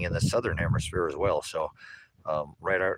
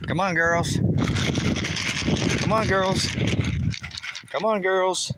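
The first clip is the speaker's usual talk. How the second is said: calling the hens; 12 semitones higher than usual. Real, not acted. Farmer calling the hens to come, high sing-song call. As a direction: call the chickens in, lilting.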